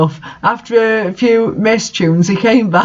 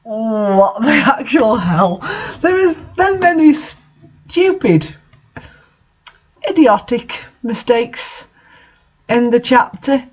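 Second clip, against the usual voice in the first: exasperated; 5 semitones higher than usual. Real, not acted. Reader's exasperated comment on the chapter.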